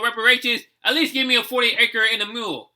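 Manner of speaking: usual speaking voice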